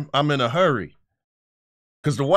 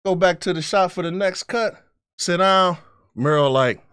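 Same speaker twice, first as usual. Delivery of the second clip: normal talking voice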